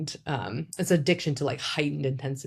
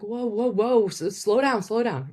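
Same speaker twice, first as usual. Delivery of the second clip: urgent whoa; 6 semitones higher than usual. Shown as a demonstration. To her mom talking too fast, raised urgent whoa. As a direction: rein in a fast talker, a bit raised. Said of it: "I'm like".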